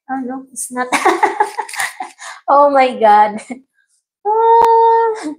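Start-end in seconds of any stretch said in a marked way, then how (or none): 4.3-5.3 high-pitched sustained "aah". Real, not acted. After fumbling the song for viewers, high drawn-out "ah". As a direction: let out a long high cry of "ah".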